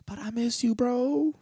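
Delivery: high, cracking call to him; high plaintive cry to his lost uncle; aching plea. Real, not acted. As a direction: reach out to the uncle you miss. Addressing late uncle, emotional.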